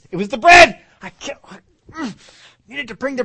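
shrill-high, very loud comic panic; mock panic. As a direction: blurt it out like a guilty disciple. Acting flustered disciple.

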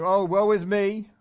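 hammed-up woe-is-me wail, high and loud; mock lament. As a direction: complain theatrically, stretching out the self-pity. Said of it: "after crying all night and saying".

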